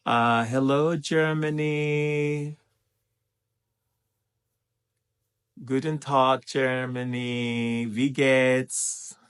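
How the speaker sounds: high, drawn-out German hello; greeting call. Real, not acted. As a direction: call out to Germany, holding the vowels.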